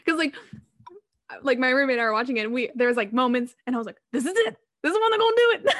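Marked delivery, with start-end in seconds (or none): 4.1-5.7 high-pitched, loud thrilled exclamation; excited anticipation. Retold or imitated. Viewer reliving the big moment with her roommate, excited. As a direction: burst out like an eager viewer. Acting out excitement while watching movie.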